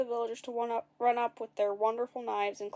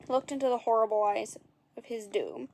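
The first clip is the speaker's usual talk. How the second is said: ordinary delivery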